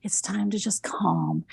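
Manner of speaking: whispered, calming the dog: "it's time to just calm"; soothing whisper. Retold or imitated. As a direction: breathe it out softly to settle a pet.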